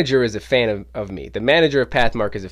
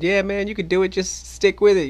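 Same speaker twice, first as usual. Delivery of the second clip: high-pitched, put-on friendly chat; making small talk; 9 semitones higher than usual. To the store manager, high-pitched acted small talk. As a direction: keep up small talk in a raised voice. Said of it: "just trying to small talk it up".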